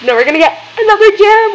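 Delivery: high, quick and thrilled; bubbling with excitement; excited. Real, not acted. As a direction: rush it out with glee. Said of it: "I'm so excited".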